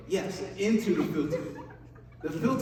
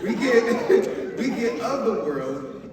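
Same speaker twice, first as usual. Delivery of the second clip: laughing through the words, louder at first; having too much fun; 4 semitones higher, 4 dB louder, slower than usual. Real, not acted. Preacher to audience after having too much fun, laughing. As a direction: giggle while restarting the point. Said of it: "I'm having too much fun".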